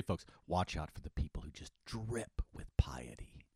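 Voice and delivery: hushed, secretive aside to the folks; mock warning whisper. Host whispering a warning to listeners about pious people.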